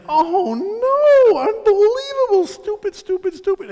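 very high, squeaky mock anguish; self-berating dismay. Comic kicking himself as words land, high-pitched dismay. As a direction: start loud, then fade into high self-scolding.

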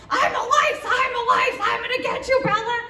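put-on high, loud, laughing monster voice to scare a girl; playful lice monster. Boy pretending to be a lice, scaring Bella, high laughing monster voice. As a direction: ham up a creepy bug coming to get her. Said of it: "And he's like".

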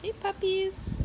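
high-pitched hello to the puppies; pet greeting. Real, not acted. Vlogger greets some puppies, high pet-talk. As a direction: coo a greeting up high to dogs.

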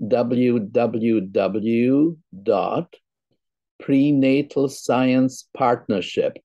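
slow, careful dictation of a web address; slow and deliberate for listeners to note down; saying it slowly. Real, not acted. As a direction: read it out slowly, phrase by phrase. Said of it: "I will say it slowly".

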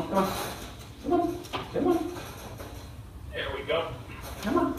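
high soft coaxing to the dog. Real, not acted. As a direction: lure the dog in with a high sweet voice.

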